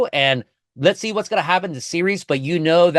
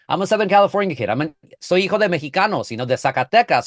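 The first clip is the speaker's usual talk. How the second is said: ordinary delivery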